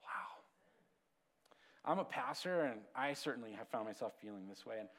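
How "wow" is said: breathy wow. Real, not acted. Reaction after reading.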